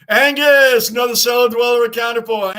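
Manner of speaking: loud, high shout-out to a new viewer; hyped shout welcoming a viewer; shouted greeting. Real, not acted. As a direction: call the name out big and loud. Streamer calling out a new viewer.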